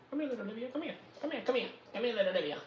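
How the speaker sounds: high, soft coaxing call to the cat. Real, not acted. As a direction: coax little Olivia over sweetly. Calling the cat.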